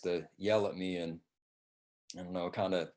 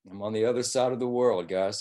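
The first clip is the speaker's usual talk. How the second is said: ordinary talk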